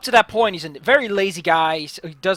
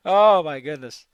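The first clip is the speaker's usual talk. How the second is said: ordinary talk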